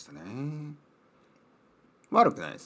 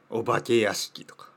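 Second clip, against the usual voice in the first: ordinary delivery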